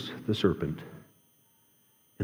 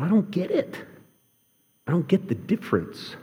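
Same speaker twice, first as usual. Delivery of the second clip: much higher, baffled inner voice; mock bewildered, pitched up; confused; 10 semitones higher than usual. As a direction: play your own puzzled past self. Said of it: "I thought".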